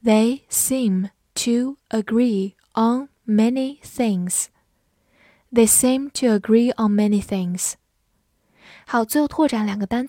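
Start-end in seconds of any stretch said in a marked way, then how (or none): 0.0-4.5 slow, careful read, each word apart. Shown as a demonstration. Teacher slowly reading the sentence aloud for the class. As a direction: stretch the sentence out slowly for learners. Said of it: "好，慢读一遍".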